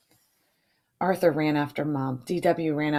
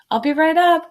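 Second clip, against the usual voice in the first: high, loud call from downstairs; 11 semitones higher, 8 dB louder than usual. A narrator's reading. Mom calls up to the kids while gaming, high and loud. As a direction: answer the kids from far off. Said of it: "called Mom".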